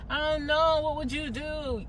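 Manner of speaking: asking for advice. Retold or imitated. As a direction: play someone asking for advice, unsure. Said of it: "if you didn't hear me ask you".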